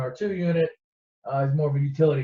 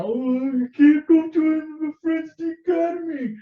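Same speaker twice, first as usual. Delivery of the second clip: high, slow mocking whine; whiny Luke; 13 semitones higher, 5 dB louder, slower than usual. Luke complaining to the droids, slow high whine. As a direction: drag it out like a sulking kid. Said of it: "bitching about".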